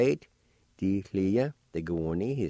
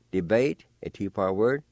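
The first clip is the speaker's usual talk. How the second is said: ordinary delivery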